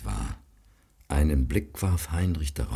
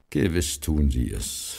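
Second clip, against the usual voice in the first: ordinary delivery, nothing marked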